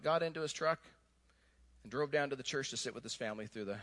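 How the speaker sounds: ordinary talk, nothing marked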